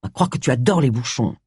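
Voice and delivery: low, grumpy sarcasm; grumbling. A narrator's reading. James grumbling as the taxi driver pulls away. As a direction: grumble drily at your companion. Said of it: "grommela James".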